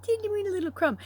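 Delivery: put-on high, quick, playful "teeny weeny"; lilting baby talk for something small; cutesy tiny voice. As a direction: make it sound tiny and cute, quickly.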